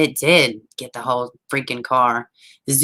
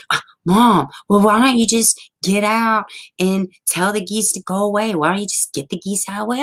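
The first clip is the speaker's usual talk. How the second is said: imitated whiny kid; whining son; 6 semitones higher than usual. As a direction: plead with mom, pitched up. Son whining at mother, retold.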